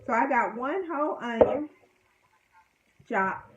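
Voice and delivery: ordinary delivery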